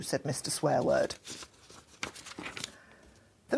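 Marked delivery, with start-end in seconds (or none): none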